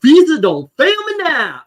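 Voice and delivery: high loud mocking caricature; minstrel caricature voice. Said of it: "expect him to go".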